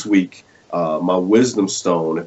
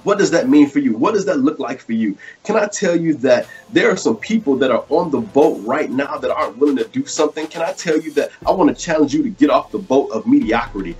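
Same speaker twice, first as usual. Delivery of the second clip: higher-pitched, rolling sermon cadence; fervent preaching; 8 semitones higher than usual. Real, not acted. Preacher challenging viewers to get off the boat, fervent preaching. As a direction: preach it with rising urgency, punching the key words. Preaches the wisdom stone message.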